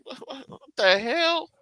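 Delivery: bewildered what-the-hell; incredulous. Retold or imitated.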